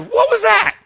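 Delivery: sudden high, loud alarmed cry; jolted by the thump, blurt it out; startled shout. Retold or imitated. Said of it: "I said to my wife".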